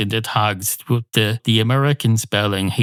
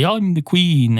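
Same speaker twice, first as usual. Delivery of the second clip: mock queen; 7 semitones higher, slower than usual. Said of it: "every day she'd get up and say".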